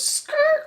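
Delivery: high mock tire squeal; mimicked skid noise; tire screech. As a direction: imitate brakes screeching. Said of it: "She goes".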